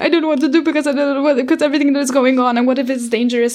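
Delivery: fast, high, anxious rush of words; frantic rambling. Retold or imitated. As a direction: blurt it all out in a panic. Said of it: "I just remember being like".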